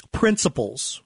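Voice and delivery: ordinary talk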